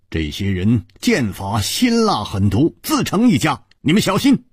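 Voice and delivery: usual speaking voice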